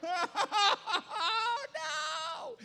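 high, loud comic wail; horrified shout. Retold or imitated. Pastor reliving the cat's return, loud high wail. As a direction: wail in mock despair.

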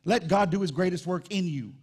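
usual speaking voice

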